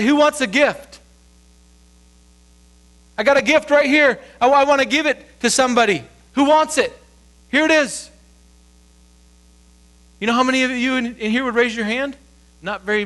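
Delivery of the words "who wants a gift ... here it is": raised, higher voice, offering a gift around the room; calling out to the crowd. Retold or imitated. Pastor calls to the congregation, holding out a wrapped gift, high and eager. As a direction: call out to the room, holding up a present. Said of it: "and I say".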